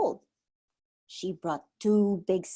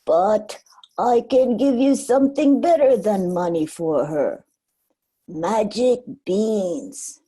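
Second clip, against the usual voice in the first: put-on louder, tempting old man offering a deal; sly old man; 7 dB louder than usual. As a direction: sell the magic beans like a secret. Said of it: "he told Jack".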